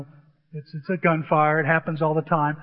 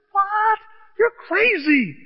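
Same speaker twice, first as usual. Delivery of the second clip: high, loud disbelief; incredulous outburst; 13 semitones higher, 4 dB louder, slower than usual. Retold or imitated. Player balks at living off the land, incredulous. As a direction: exclaim it as an appalled protest.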